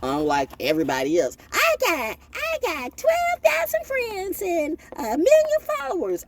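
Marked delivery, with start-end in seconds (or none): 1.5-6.2 high, slow mocking show-off voice; mock braggart. Mocking a braggart about his follower count, high and slow. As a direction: sneer it out like a bragging influencer.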